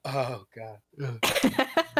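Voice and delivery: quiet mock-goth "oh God" with a groan; goth disdain. Teasing a friend's goth instincts, mock-goth disdain. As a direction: imitate a goth's hushed disgust. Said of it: "She swooped her hair and was like".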